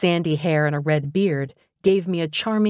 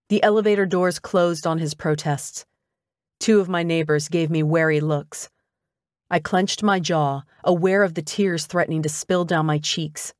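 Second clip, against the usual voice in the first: usual speaking voice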